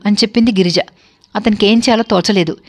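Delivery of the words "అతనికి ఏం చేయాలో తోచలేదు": ordinary delivery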